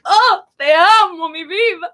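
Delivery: high, gushing, hammed-up endearment; exaggerated love declaration. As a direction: overdo the romance for a husband. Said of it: "it's very exaggerated for him".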